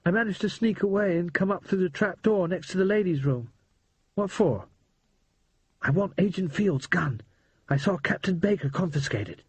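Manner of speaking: put-on a scared but excited boy spilling the news; boy Percy, urgent.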